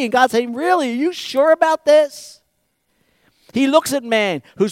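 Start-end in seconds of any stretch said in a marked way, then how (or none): 0.5-2.4 high, loud incredulous questions; mocking disbelief. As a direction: challenge them with amused scorn. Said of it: "you're mocking them".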